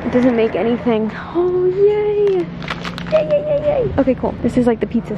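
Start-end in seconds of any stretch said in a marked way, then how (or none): 1.3-3.9 high, giddy repeated yays. Real, not acted. Vlogger squealing yay at her grocery find. Delighted at finding item.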